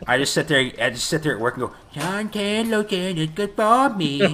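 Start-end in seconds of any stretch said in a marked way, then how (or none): 1.9-4.3 very high, drawn-out falsetto; high cartoonish mimicry; goofy quoting voice. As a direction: squeal it slowly like a silly movie quote. Acts out quote used at work.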